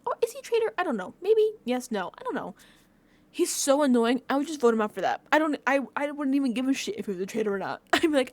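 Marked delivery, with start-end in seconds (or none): 0.1-2.5 dithering debater. Retold or imitated. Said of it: "They're debating, like".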